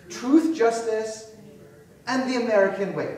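mock-heroic movie trailer read; heroic slogan. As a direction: boom it like a cartoon announcer. Quoting Superman's slogan.

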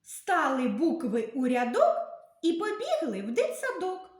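lively sing-song nursery rhyme; rhyme for kids. Acted. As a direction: recite it like a children's poem.

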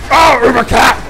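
loud high shout at an enemy; excited shout. Real, not acted. Player shouting at an enemy on screen, loud high yell.

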